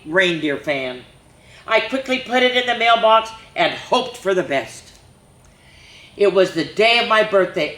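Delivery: put-on lively storybook reading for little kids; reading to children. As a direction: read it like story time at the library. Reads picture book to children.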